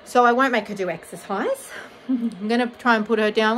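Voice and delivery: normal talking voice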